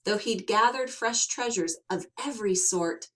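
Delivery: usual speaking voice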